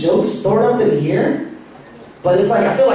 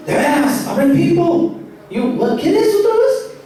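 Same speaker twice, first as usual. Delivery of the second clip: eager uncle, climbing higher as he goes; 8 semitones higher than usual. Retold or imitated. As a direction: pitch it up like an excited homeboy.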